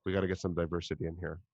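low, quick mock-corporate line; mock meeting attendee. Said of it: "They're like".

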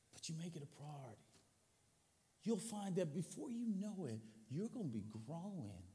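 quiet, low pastoral plea; soft earnest appeal. Acted. Pastor asking the church to commit, soft and low. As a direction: plead gently and quietly.

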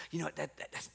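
halting soft start sinking into a whisper; hesitate, then whisper the quiet warning. Acted. Holy Spirit cautioning someone about a job, whispered. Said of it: "trying to whisper in our heart".

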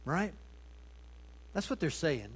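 ordinary talk, nothing marked

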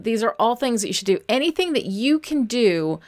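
normal talking voice

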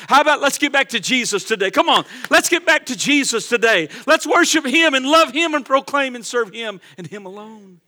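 loud, fervent preaching to the church; full-force preaching. Acted. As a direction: drive it home like a revival preacher.

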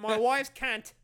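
Jim Jeffries. Acted. Host echoes the crude impression, emphatic Australian accent. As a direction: punch it like an Aussie comedian. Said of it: "so used to saying it, there's, like, a lot of emphasis".

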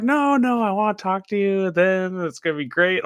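high, sugary mom impression; high sweet mom voice. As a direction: coo it like a doting mom.

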